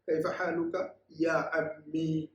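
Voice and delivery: slow, careful Arabic model phrase. Shown as a demonstration. Teacher models the Arabic greeting slowly for students. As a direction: say Kaif हालुका या अम्मी slowly and clearly. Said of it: "तो उसको अरबी में कहेंगे".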